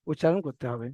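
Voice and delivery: ordinary talk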